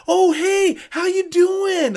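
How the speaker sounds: high, loud, sugary fake hello; fake cheerful greeting. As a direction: sing out a phony cheery hi. Imitating fake friendly greeting.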